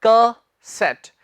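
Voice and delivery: CASSETTE split slowly, kuh - SET; syllable by syllable. Shown as a demonstration. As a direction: pause between the two parts of CASSETTE. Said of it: "एक साथ बोलना है".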